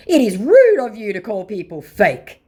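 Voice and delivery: loud, high, hammed-up indignation; mock offended scammer. Vlogger reading the scammer's complaint, in a mock-offended voice. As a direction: declaim it like a wounded impostor. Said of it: "said the scammer who just called me fake".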